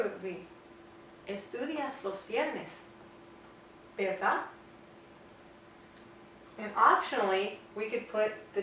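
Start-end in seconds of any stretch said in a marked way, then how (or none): 1.3-4.4 slow "Estudias los viernes", pause, then "Verdad" rising; statement plus tag question. Shown as a demonstration. Teacher asking isn't that true, statement then rising tag. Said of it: "make the statement and then ask".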